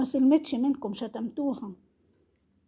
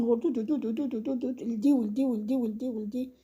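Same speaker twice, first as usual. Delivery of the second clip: mock gossip chatter. Mocks her gossiping on phone.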